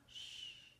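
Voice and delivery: whispered shush. Acted. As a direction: hush them like a secret. Hushing gesture.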